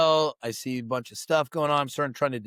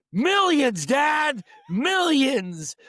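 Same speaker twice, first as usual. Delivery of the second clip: much higher, shouted answer; excited shout; 12 semitones higher, 3 dB louder, much slower than usual. Retold or imitated. Son shouting to his dad about the prize money, excited. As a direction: blurt it out thrilled.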